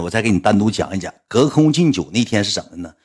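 ordinary delivery

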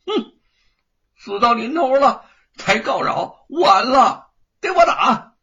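loud, high, vicious shout; a bully ordering his servants to attack; brutal sneer. A narrator's reading. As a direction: bark the order to beat him. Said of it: "粗横地狞笑了一声".

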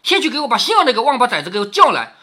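acted scolding, building in volume; cursing. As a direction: berate servants and bark an order. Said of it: "骂道".

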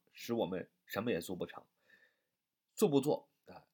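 normal talking voice

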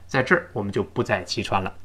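ordinary talk, nothing marked

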